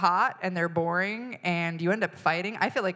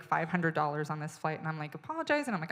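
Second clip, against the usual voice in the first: ordinary talk, nothing marked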